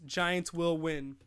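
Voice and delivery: ordinary talk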